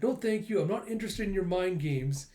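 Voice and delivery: high-pitched character voice; prim refusal. Acted. Yor rejects the assassin's offer, high firm refusal.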